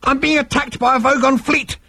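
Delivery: very high, frantic outburst; desperate cry. A narrator's reading. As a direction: cry out in panic to be heard. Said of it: "cried Zaphod".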